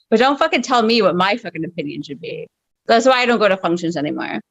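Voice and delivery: usual speaking voice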